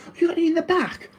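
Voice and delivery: very high, fast whiny customer voice; mocking pushy customer. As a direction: squeak it out like an entitled latecomer. Mocking a late customer asking.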